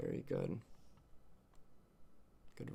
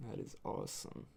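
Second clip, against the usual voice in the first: whispered; 7 dB quieter than usual. Real, not acted. Quiet aside after the goodbye.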